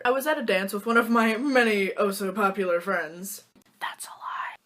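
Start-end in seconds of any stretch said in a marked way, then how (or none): 3.8-4.6 whispered joke to the viewer; whispered aside. Real, not acted. Admits to viewers her friends aren't popular, whispered aside. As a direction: drop to a confiding whisper.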